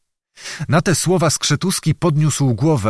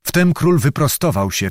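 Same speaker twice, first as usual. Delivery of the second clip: ordinary delivery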